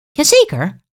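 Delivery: high, cheerful yes; bright affirmation. A narrator's reading. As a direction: chirp it out brightly. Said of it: "antwoordt de mevrouw".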